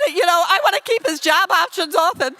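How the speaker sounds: loud, high comic wailing; hysterical crying, acted. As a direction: wail at your husband in hammed-up hysterics. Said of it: "I'm hysterical".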